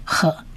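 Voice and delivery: "呵" as a light, soft h syllable; lighter sound. Shown as a demonstration. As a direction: say "呵" softly as an isolated sound. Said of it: "我们念轻一点的音叫呵". (轻轻地念“呵”，示范较轻的音)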